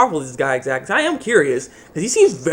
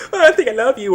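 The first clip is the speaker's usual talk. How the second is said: lightly voiced girly confession, higher; mock love confession; 8 semitones higher than usual. Heroine confessing to the hero, mock swooning and higher. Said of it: "She's like".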